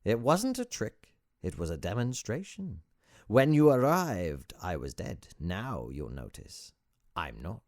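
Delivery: mischievous guru, swooping high then dropping; showman sage with foreign accent. Acted. Sage demonstrating to his visitor, theatrical accented showman. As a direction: present the miracle like a practised routine.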